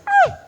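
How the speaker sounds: pained yelp. Retold or imitated. Fight breaks out, cry.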